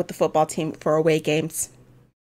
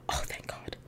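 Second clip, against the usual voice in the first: softer, breathed out in relief; whispered relief; 20 dB quieter than usual. Real, not acted. Player whispering relief after dodging exposure.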